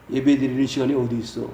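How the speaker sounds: low, contained scolding question; restrained rebuke. Acted. Preacher chiding those with no time for worship, restrained rebuke. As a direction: begin the reproach in a low voice.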